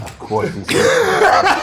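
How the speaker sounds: high, slow, laughing mock; laughing mockery. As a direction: squeal it out through laughter. Mocking friend's mops claim.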